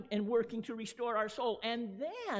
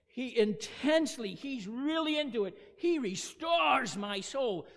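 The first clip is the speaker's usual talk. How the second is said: intense proclamation; 2 semitones higher, 4 dB louder than usual. Shown as a demonstration. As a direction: declare it with full intensity.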